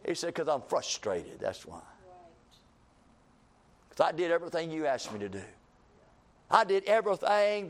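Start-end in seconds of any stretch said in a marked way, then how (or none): 0.3-1.8 tired prophet's mumble; dejected reply. Acted. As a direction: let the words sink, worn out. Elijah answers, dejected.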